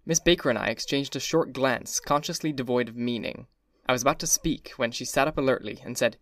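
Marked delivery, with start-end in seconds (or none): none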